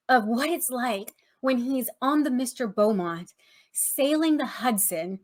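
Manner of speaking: ordinary talk, nothing marked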